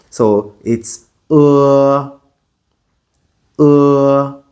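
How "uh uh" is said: "Uh" with mouth slightly open, tongue curled back; "Uh" tongue pulled back; Mandarin e vowel. Shown as a demonstration. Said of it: "this part of my mouth moves forward and then backward".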